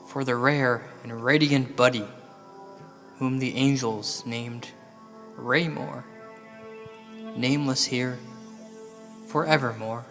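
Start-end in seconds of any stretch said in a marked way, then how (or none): none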